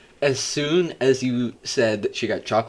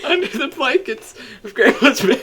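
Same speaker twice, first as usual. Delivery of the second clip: laughing; 11 semitones higher, 5 dB louder than usual. Real, not acted. Adding detail, laughing.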